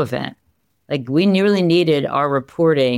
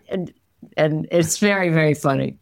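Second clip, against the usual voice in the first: usual speaking voice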